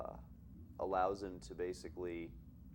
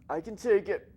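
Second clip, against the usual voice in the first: high, loud, put-on protest; mock hurt; 12 semitones higher, 11 dB louder than usual. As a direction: insist in an exaggerated, raised voice, as a joke. Jokes about taking the criticism.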